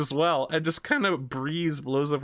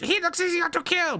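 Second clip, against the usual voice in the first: squeaky high-pitched goblin taunt; high kobold voice. Acted. Kobold sneering at the weak-looking halfling, high and squeaky. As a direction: pitch it way up like a nasty little kobold. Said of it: "one of them notices you and is like".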